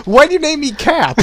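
high, loud indignant cry; a real yelp of outrage at the game; outraged yell. Real, not acted. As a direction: shout it up high in disbelief.

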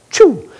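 high, loud "choo"; one quick, high burst of imitated sound; sudden snap-to sound effect. Storyteller showing the audience an instant return to calm, sudden high "choo". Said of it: "just like that".

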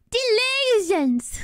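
put-on high, stretched British-accented cry; Tom Holland. Copying the movie take for laughs, high British accent. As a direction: draw it out high, mimicking British outrage. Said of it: "To me, it sounds really British".

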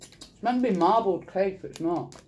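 speaking between bites; talking while chewing. Real, not acted. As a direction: mouth partly full of cake. Seen 0: Left speaker bit cake at -1, mouth working while talking.

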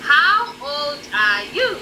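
normal talking voice

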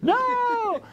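high, loud mock scream of protest. Baptists watching the movie protest, mock scream. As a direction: shriek it like horrified Baptists. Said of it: "Baptists everywhere are just screaming".

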